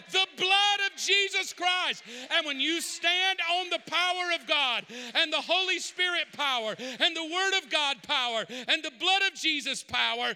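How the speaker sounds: loud, high, driving sermon voice; fiery preacher pressing the church; full-force preaching. Acted. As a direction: preach it hard to the room.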